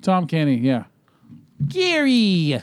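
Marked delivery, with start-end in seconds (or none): 1.7-2.6 high, stretched cartoon call; SpongeBob. Showing the cartoon voice they just named, high drawn-out call.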